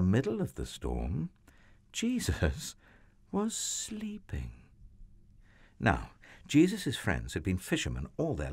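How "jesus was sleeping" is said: high, soft, slow near-whisper; hushed reveal. A narrator's reading. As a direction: drop to a hush, as if not to wake him. Hushed contrast after the storm.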